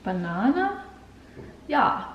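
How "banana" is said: "Banana" with a German accent, hard p-like start, open a's. Shown as a demonstration. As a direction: try "Banana" the German way, questioning. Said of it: "how to say banana with a German accent".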